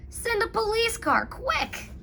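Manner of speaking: high, urgent rising call; urgent plea. A narrator's reading. Michael urging Clancy to send a car, high and urgent. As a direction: hurry him up, pitch rising. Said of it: "yelled Michael".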